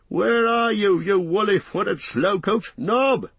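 high, loud scolding call; impatient shout. A narrator's reading. Landlord to his slow servant, shouting impatiently. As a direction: holler high for a dawdling servant. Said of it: "he shouted".

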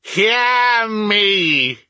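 very high, loud wail; ghostly cry. A narrator's reading. The ghost demands that Scrooge listen, wailing cry. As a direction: cry it out, holding the pitch high. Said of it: "cried the ghost".

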